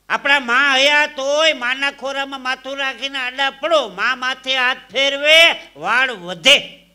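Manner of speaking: loud, slow impassioned address; passionate speech. Acted. Speaker praising mothers to the audience, loud and slow. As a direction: build a heartfelt loud tribute. Emotional preaching about mother.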